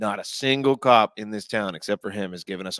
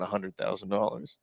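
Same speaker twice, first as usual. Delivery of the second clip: usual speaking voice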